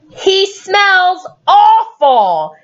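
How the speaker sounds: slow, drawn-out disgust; disgusted mother. Acted. Parent voicing mother complaining about the dog. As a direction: stretch out awful in distaste.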